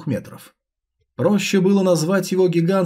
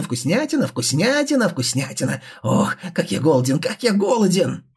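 piercing ghoul voice. Acted. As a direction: savour each word like a starving ghoul. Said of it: "Пронзительный и резкий голос".

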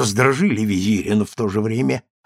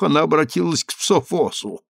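ordinary delivery, nothing marked

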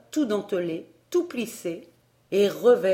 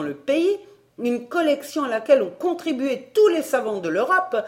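high, loud, indignant rant; a pedantic collector boiling over; furious little gnome; 5 semitones higher, 4 dB louder than usual. Acted. Gnome scolding the narrator over his fossils, high and furious. Enraged gnome berates visitor.

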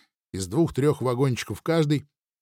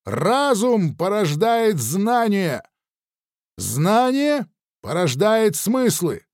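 slow, high, declaiming shout; shouted postulate; 7 semitones higher, 3 dB louder, much slower than usual. Acted. Nikita shouts the first postulate to the thinker, slow proclaiming. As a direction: proclaim each creed line slowly. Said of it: "выкрикнул я первый постулат".

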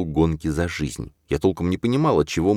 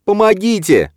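high, loud desperate cry; screaming for help; 7 dB louder than usual. A narrator's reading. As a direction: cry out while pounding door. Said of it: "кричала она".

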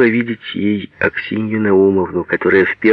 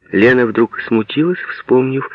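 ordinary delivery

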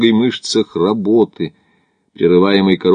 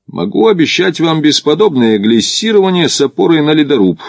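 breezy, loud and raised promise; careless; 8 semitones higher, 5 dB louder than usual. A narrator's reading. Climber waving away his companion's worry, careless. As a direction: brush the worry off lightly. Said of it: "небрежно отмахнулся Макдональд".

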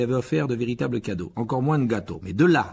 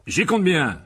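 high, forceful retort; loud voice; 8 semitones higher, 8 dB louder, slower than usual. A narrator's reading. Uncle warning the boy to stay hidden, loud. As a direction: fire it back loudly and firmly. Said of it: "d'une voix forte".

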